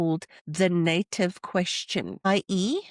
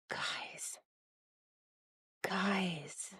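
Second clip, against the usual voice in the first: breathy unvoiced whisper of guys; 14 dB quieter than usual. Real, not acted. Creator whispering guys to viewers before thanking staff. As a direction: lean in and whisper to the audience.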